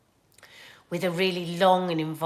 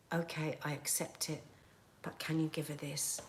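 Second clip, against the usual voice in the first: soft, lower, quietly hurt voice; retold subdued acceptance; 4 semitones lower, 13 dB quieter than usual. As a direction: act out a crestfallen boy, very softly. Retelling rejected boyfriend's upset reply.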